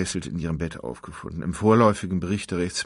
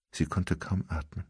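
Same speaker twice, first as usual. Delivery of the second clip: ordinary delivery, nothing marked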